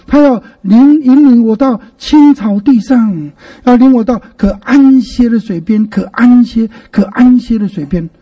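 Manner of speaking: 青草地 and 安歇 stretched out and pushed; heavy stress on key words. Shown as a demonstration. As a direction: lean long on 安 in 可安歇. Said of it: "重点重重的读它一下".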